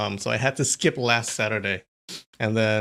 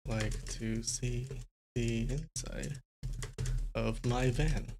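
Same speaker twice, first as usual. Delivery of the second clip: slow, hushed word-by-word reading; typing-along murmur; 11 dB quieter, slower than usual. Real, not acted. Streamer typing in a line for translation, slow quiet murmur. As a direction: say it softly and slowly as you type.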